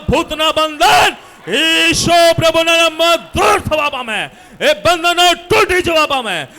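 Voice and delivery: forceful list building to a shout; impassioned preacher casting out evil; deliverance prayer. Real, not acted. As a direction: command each bondage to break.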